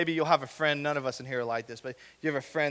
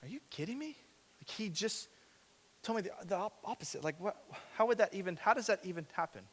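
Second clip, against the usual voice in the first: higher, softer, incredulous; baffled can't-believe-it; hushed high shock; stunned disbelief; 4 semitones higher, 9 dB quieter than usual. Retold or imitated. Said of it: "I was like".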